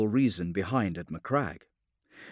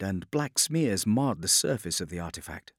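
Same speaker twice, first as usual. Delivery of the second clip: ordinary delivery, nothing marked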